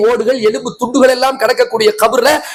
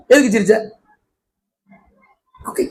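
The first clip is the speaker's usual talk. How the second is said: ordinary talk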